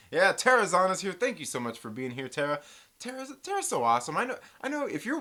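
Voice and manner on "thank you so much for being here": ordinary talk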